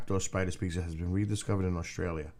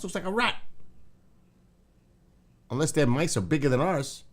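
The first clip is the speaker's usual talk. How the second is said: usual speaking voice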